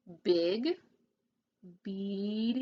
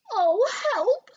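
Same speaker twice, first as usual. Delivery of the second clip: much higher, loud frightened yelp; shivering cry; 11 semitones higher, 8 dB louder than usual. A narrator's reading. Pig seeing the wolf on the roof, terrified. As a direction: squeal for help. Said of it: "shivered Horace".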